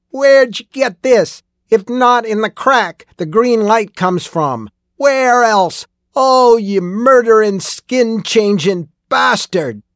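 high, loud enraged accusation; furious shouting. A narrator's reading. Old miner confronting killer over the watch, furious shouting. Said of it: "He was furious".

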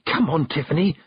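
lightly voiced girl egging a friend on; urging. A narrator's reading. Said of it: "Dimity urged".

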